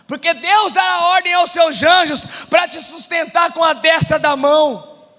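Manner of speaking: loud, ringing scriptural quotation; declaimed challenge. Retold or imitated. Devil tempting Jesus at the temple, loud declamation. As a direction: proclaim it forcefully, slowing at the end.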